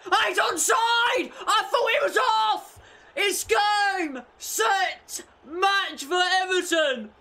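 high, loud triumphant yelling; ecstatic shout. Real, not acted. Gamer screaming as Everton's winner stands. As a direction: scream it like you just won the game.

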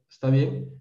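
ordinary talk

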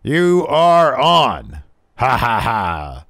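slow, loud mock villain laugh. DiBiase accepting the bet with a slow villain laugh. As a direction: draw out the evil laugh.

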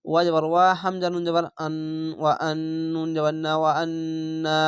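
ordinary delivery

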